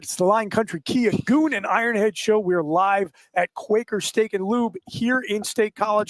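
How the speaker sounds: upbeat host station ID; radio break read. Acted. Host going to commercial break, bright announcer read. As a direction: sell the show like a live radio host.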